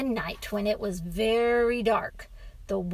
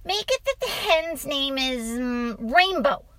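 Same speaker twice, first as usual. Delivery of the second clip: put-on higher little-boy voice; boy making suggestions; 5 semitones higher, 5 dB louder than usual. A narrator's reading. Boy names the hen for his father, eager. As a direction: think it up eagerly in a child's pitch. Boy invents the hen's name.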